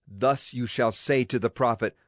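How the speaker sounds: ordinary delivery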